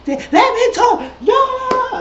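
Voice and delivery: very high, hammed-up screech; mock screaming. Retelling Toya screaming at her husband, high mock scream. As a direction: imitate a tantrum at full squeal. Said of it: "screaming at her husband".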